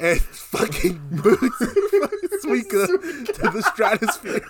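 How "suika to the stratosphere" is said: high-pitched laughing through words. Real, not acted. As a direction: crack up while telling it. Cracking up retelling kick.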